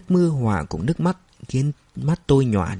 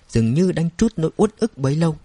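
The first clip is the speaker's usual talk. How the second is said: ordinary delivery, nothing marked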